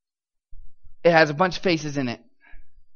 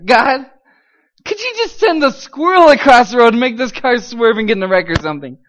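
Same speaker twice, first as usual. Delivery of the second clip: loud, high mock-desperate begging; exasperated plea to God; 6 semitones higher, 9 dB louder than usual. Captive in police car begging God, comically desperate. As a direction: beg heaven loudly for any way out.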